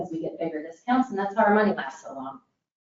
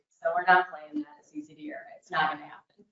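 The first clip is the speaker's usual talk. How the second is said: whispered aside; 9 semitones higher, 6 dB quieter than usual. Real, not acted. Speaker assures rural audience, pointed whisper. Defiant aside about outside control.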